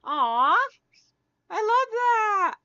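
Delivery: high, loud, drawn-out delight; delighted gushing. Real, not acted. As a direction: gush over something adorable, stretching the words. Gushing over a viewer's comment.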